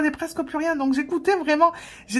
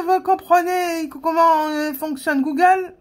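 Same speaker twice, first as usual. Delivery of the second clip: imitating slow salesman; 5 semitones higher, slower than usual. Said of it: "je crois qu'il a dit".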